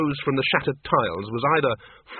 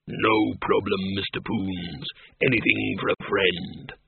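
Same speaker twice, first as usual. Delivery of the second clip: low, slow genial monster voice; a big hairy creature, easygoing and kind; friendly bogeyman; 4 semitones lower, slower than usual. A narrator's reading. The bogeyman greets old friends in a low friendly voice.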